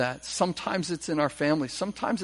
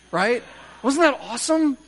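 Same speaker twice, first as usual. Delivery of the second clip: ordinary talk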